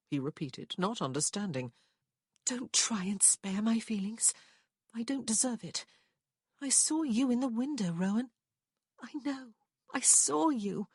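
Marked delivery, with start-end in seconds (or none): 2.5-10.9 put-on higher young woman, hurt and ashamed; lightly voiced heartbroken woman; Prue's voice. A narrator's reading. Prue confessing to Rowan what she saw, hurt.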